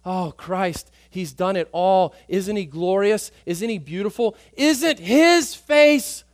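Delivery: preacher voicing a worshipper in ecstatic awe; rapturous praise. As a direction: marvel at him out loud, building in awe.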